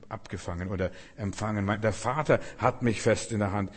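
ordinary delivery

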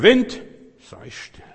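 loud, high, slow command; commanding shout. Acted. Jesus rebuking the wind with a shout.

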